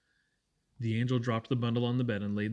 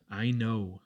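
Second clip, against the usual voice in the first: plain reading voice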